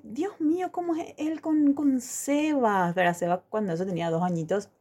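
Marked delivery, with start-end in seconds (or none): 0.0-2.9 much higher, friend's astonished question; a friend's amazed, high exclamation; amazed exclaim. Retold or imitated. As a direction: gasp it out, slowing at the end. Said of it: "Y me dice".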